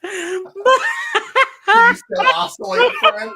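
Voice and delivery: high, slow plea broken up by laughter; laughing plea. Real, not acted. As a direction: beg the dead fan while cracking up. Begging his broken fan.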